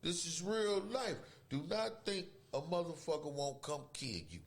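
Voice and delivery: ordinary delivery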